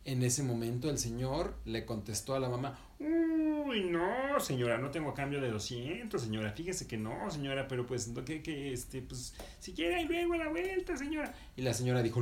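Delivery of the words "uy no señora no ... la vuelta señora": higher, hemming and hawing seller; fussy vendor voice. Acted. Vendor tells the lady he has no change, flustered voice. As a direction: fumble for excuses like a flustered street seller.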